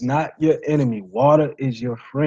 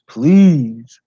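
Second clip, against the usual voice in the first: pleading; 7 dB louder than usual. Real, not acted. Final plea.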